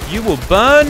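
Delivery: high, loud hammed-up threat; mock menace. Gamer taunting burning enemies, hammed-up threat. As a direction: taunt them like a cartoon villain.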